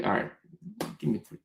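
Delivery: ordinary delivery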